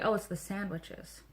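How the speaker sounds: ordinary talk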